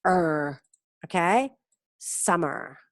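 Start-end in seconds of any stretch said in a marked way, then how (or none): 0.0-0.6 "er" as one long r-coloured vowel; English final-R sound. Shown as a demonstration. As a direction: hold "er" like the end of summer. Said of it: "siempre los Rs son er". (er alargada, como el final de summer)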